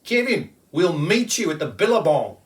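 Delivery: high, loud playful call across the bush; children shouting happily; shouting kids. A narrator's reading. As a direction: call out to a friend as you run off to play.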